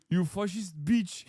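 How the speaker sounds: laughing mock insult. Mocking chatter insult, laughing.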